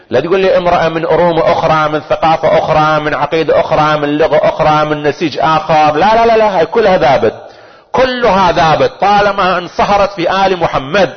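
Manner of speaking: high, driving list rising to a climax; impassioned preaching. Acted. Preacher rejecting talk of her foreign origins, impassioned preaching. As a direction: sweep away each objection with mounting force.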